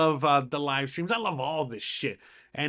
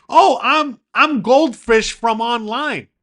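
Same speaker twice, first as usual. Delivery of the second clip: high, loud, slow self-important fan; indignant fan; 8 semitones higher, 11 dB louder, much slower than usual. Retold or imitated. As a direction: announce your online name as if everyone should know it. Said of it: "And they're like".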